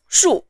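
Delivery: shu falling sharply from high; fourth tone. Shown as a demonstration. Teacher showing learners the fourth tone, high fall. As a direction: start shu high and drop it fast. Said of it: "is of the fourth tone".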